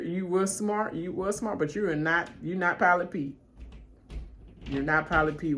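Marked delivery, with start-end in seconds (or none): none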